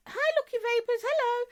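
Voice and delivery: very high-pitched hello; excited greeting. Real, not acted. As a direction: squeal a welcome to a viewer. Greeting viewers as they join.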